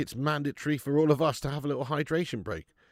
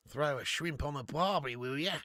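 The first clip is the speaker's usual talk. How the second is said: put-on jokey broad Australian accent; Aussie impression. Streamer asking for barbecue shrimp, mock Australian accent. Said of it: "Do an Aussie impression?".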